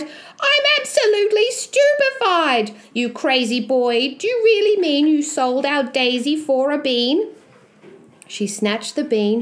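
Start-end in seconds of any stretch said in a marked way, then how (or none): 0.0-7.3 high-pitched, stupefied mother; outraged cry. Acted. Mother aghast at her son selling the cow, shrilly outraged. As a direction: shoot up high in disbelief, then scold. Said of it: "leaped high in the air and cried".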